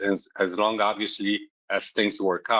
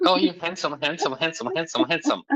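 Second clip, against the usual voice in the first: lightly mocking, higher voice, repeating handsome; hammed-up flattery, pitched higher; mocking partner's flattery; 9 semitones higher than usual. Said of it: "I'm like".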